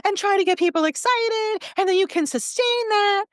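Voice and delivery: high, performed enthusiasm, far from the natural register; high put-on voice. Shown as a demonstration. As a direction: push the voice high into fake excitement. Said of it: "be up here".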